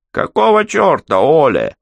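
high, loud scolding woman's voice; hoarse angry woman. A narrator's reading. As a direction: snap it out shrilly as an irate barmaid. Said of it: "раздался хриплый женский голос". (хриплый сердитый женский голос)

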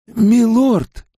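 high-pitched, urgent exclamation; agitated servant. A narrator's reading. Servant calling to his lord about the seals, agitated.

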